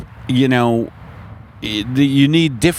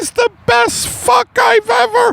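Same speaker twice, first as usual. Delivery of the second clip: very high, drawn-out falsetto wail; hammed-up porn climax; porn actress; slower than usual. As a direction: squeal in mock ecstasy. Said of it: "while pornography is playing".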